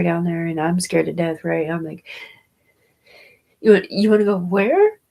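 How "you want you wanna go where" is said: louder, disbelieving retold question; shocked question. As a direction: replay the shock. Retells shocked reply to daughter.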